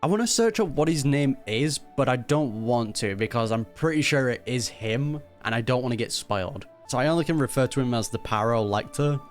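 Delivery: usual speaking voice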